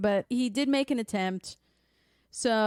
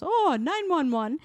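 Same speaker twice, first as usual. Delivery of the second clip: high, mock eager answer; higher mimicked quiz answer; imitated parent; 7 semitones higher than usual. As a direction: pipe up as the parent who knows. Voices parent's answer to quiz question.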